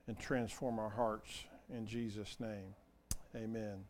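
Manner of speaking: soft, low, unhurried praying voice; hushed prayer with long pauses. Real, not acted. Preacher inviting the Holy Spirit to speak, soft low prayer.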